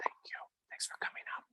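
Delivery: soft whispered thanks; whispering. Real, not acted. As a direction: whisper it aside. Whispered aside to a child.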